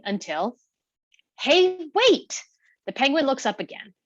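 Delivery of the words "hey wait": high-pitched bird cry; annoying, high albatross holler; screechy albatross. A narrator's reading. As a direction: call after someone in a high screech. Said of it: "voice is high-pitched and screechy".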